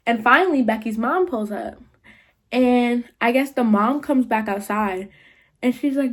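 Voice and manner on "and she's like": plain reading voice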